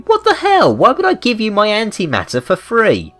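ordinary talk, nothing marked

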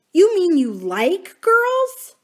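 put-on high, slow, disbelieving little-girl voice; scornful young girl. Mary doubting Uncle Tom's taste in girls, high, slow and scornful. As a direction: drag it out in disgust.

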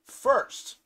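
announced loud, then the voice drops; announcing. Acted. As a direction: proclaim it like a big reveal. Building suspense before crafting.

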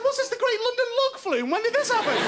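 high, laughing confusion; mock bewilderment. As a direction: act baffled at the sudden boat. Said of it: "It's going".

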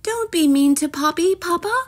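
high, pleading sisterly voices; high-pitched protest; sisters crying out. A narrator's reading. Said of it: "cried her sisters".

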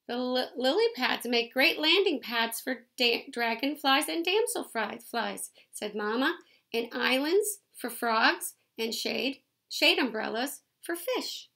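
lively picture-book read-aloud; reading to children. A narrator's reading. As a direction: read it out to the kids, bright and clear.

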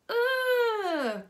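high loud ugh of disgust; boy crying out at a stink. A narrator's reading. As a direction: let out a pained ugh. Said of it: "cried a boy".

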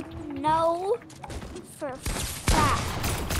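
drawn out. Pretend. Drawn out teasing retort.

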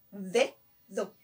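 "Dedo" in two separate syllables, low; split into syllables. Shown as a demonstration. Teacher pointing out the two Ds, "Dedo" syllable by syllable. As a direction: break "Dedo" into de and do. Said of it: "tiene dos D".

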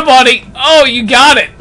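ordinary talk

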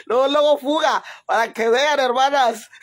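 laughing. Real, not acted. Loud laughing commentary.

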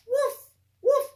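loud, high playful woofs; dog barks. Acted. Teacher barking for Biscuit the puppy, loud and playful. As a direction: bark like a happy puppy.

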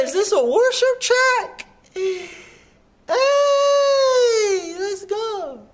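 high, thrilled disbelief; excited surprise. Real, not acted. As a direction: squeal it out in delight. Hyped reaction to worship track.

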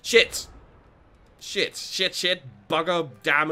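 sharp high shout of frustration; loud curse. Real, not acted. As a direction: yell it at the bad roll. Dice roll goes wrong.

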